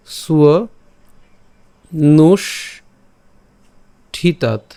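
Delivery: সুয়, long pause, নুষ, long pause, ঠিতাত; teacher spelling out সুয় নুষ ঠিতাত in pieces; syllable-by-syllable breakdown. Shown as a demonstration. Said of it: "উচ্চারণ সুবিধার জন্য যদি লিখি".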